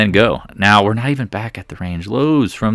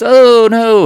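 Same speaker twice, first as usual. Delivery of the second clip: mock alarm; 11 semitones higher, 7 dB louder than usual.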